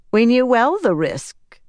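high, loud and proud. A narrator's reading. Atheniella answers Rand about the risk, proudly. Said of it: "Atheniella said proudly".

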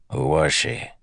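brief gravelly question. A narrator's reading. Sleet asks the guard about the victim, gravelly. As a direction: put some gravel in the question. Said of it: "in his gravelly voice".

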